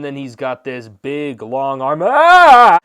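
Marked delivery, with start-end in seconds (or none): 2.0-2.8 loud, high yell of fright; sudden drawn-out startled scream; mock scream. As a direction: burst out screaming in hammed-up alarm. Mock fright at the long arm.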